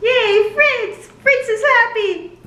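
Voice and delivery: high loud yay, slow happy; gleeful high cheer for Fritz; delighted cheer. Real, not acted. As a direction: squeal with joy for him. Cheering a character.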